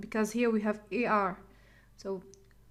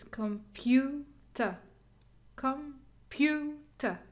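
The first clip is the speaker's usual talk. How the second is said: Computer very slowly, com, PEW, ta set apart; slow Computer with PEW drawn out; syllable stress test; 3 dB quieter, much slower than usual. Shown as a demonstration. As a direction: sound out Computer in three separate beats. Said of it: "It's not ta, because this is schwa sound".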